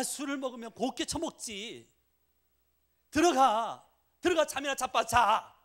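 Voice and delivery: shrill-free loud scolding wife, high and loud; nagging wife. Retold or imitated. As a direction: scold the drunk husband like a fed-up wife. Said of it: "그러면 와이프가 뭐라고요?".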